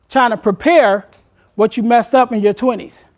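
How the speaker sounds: usual speaking voice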